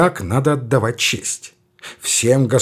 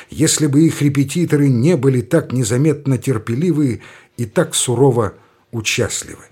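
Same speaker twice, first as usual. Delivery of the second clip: usual speaking voice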